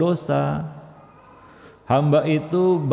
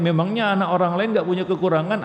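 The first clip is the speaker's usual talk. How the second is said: ordinary talk, nothing marked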